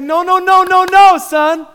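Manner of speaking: high, very loud rapid-fire refusals; urgent shout. Acted. Father stops his terrified son, shouting urgently. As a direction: cut him off at full volume.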